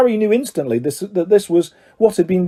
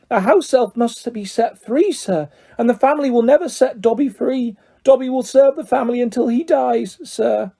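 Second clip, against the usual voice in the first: high, fluttering elf voice; a small creature overjoyed to meet his hero; eager house elf; 7 semitones higher than usual. A narrator's reading. Elf meeting Harry Potter at last, high and eager.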